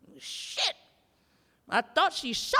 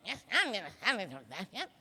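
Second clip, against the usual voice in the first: quick mumbled sass; mock attitude; 4 dB quieter, faster than usual. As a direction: imitate a woman with an attitude. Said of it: "she had an attitude".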